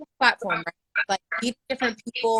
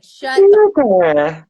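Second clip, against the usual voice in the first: mock outburst; 12 dB louder than usual. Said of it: "you're just like".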